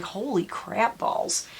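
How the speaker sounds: slow breathless whisper; stunned whisper. Retold or imitated. Stunned after watching the exposé, whispering slowly. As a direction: hush it out in shock. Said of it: "and I was like".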